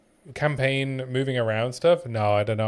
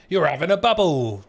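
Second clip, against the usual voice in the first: higher-pitched hammed-up Cockney; London accent; 4 semitones higher, 5 dB louder than usual. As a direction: put on a big London accent. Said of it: "You sound like you're from London".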